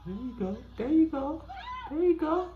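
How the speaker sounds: high squeaky animal voice; voicing an animal. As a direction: dub the critter, squeaky.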